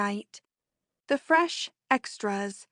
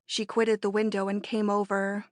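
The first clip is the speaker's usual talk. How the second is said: ordinary delivery, nothing marked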